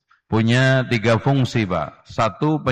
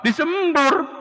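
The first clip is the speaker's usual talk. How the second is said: high mock spit of a toddler; spray it out like a two-year-old refusing food; spitting out food; 14 semitones higher, slower than usual. Toddler spitting out mother's cooking, high mock spit. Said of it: "Yang dua tahun".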